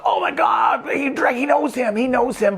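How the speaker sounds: high, excited mock realisation; mock amazed gasp. Viewer realising Dracula knows him, mock excited. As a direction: act out the audience's thrilled shock. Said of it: "we're supposed to be like".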